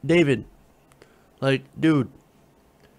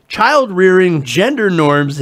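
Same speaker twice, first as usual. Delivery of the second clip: ordinary talk, nothing marked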